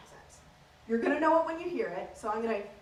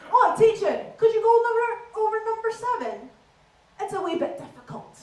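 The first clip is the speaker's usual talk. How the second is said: higher, louder put-on Scottish accent; Scottish schoolkid; 9 semitones higher, 7 dB louder than usual. Pupil asking teacher for help, in put-on Scottish accent. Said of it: "I spoke in this accent".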